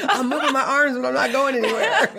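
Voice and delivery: high-pitched helplessness through laughter; laughing mock distress. Runner stuck in a dream, high laughing protest. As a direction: play helpless while cracking up.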